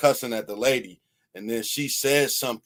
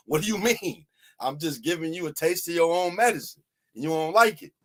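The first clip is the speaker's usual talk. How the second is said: mocking cop; 5 semitones higher than usual. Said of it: "he hold her like".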